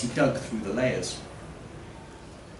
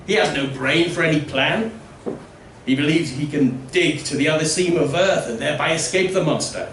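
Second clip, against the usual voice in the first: higher, louder scornful villager; 3 semitones higher, 4 dB louder than usual. Acted. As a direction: sneer at the digging fool.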